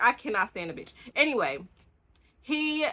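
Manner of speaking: normal talking voice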